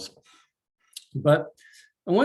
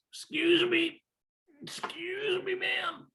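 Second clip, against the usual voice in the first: much higher, small pleading beggar voice; beggar's plea; 4 dB quieter than usual. Acted. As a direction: call up timidly like a ragged beggar. A beggar calls up to her.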